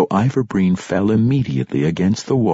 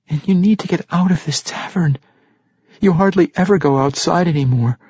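bright, put-on cheerfulness; forced cheer; 10 semitones higher than usual. A narrator's reading. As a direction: sound as cheery as possible. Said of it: "trying to sound as cheery".

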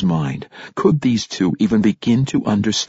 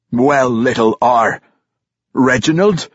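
growling king; 4 semitones higher, 3 dB louder than usual. A narrator's reading. King growling at Brunner, mocking his name. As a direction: taunt him with his name. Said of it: "Emerus Warcrown growled in his face".

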